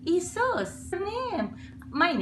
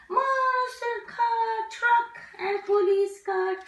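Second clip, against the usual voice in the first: higher, slow showy listing; excited announcing; 8 semitones higher, slower than usual. Real, not acted. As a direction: present the toys with wonder. Naming toys for kids.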